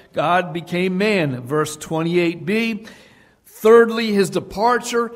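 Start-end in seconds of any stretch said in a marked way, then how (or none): 3.5-5.1 louder, higher call of the third point; preacher's loud cue; emphatic announcement. Real, not acted. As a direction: ring out the point number. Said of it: "announcing the third point".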